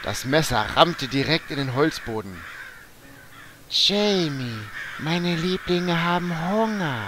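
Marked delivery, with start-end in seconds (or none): 3.7-7.0 put-on high, loud crazed old woman; menacing witch voice. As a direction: cackle it like a mad witch. Grandmother attacks with knife.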